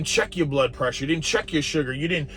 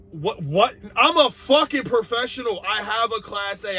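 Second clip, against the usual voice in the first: indignant outburst; 6 semitones higher than usual. Real, not acted.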